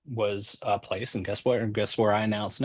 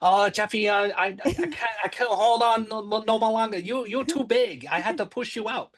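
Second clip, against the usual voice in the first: imitated Thai accent, much higher-pitched; Thai mother's accent; 12 semitones higher than usual. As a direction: do your mom's high-pitched Thai-accented English. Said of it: "she had the accent and everything".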